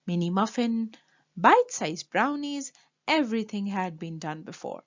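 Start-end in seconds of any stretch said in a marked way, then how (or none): none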